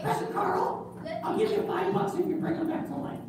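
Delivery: put-on high, wheedling boy voice; high puppet boy making a deal; bargaining puppet kid. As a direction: try to bribe the pastor.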